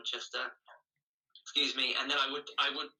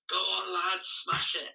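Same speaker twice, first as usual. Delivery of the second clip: higher, mildly raised mock shout; retold cheer; 7 semitones higher, slower than usual. Runner retelling builders cheering on the road, higher mock shout. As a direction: imitate builders egging someone on. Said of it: "all the builders shouting".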